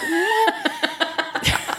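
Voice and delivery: high laughing disbelief; surprised. Retold or imitated. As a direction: squeak it out in disbelief. Retold shock at continuity error.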